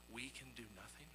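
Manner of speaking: normal talking voice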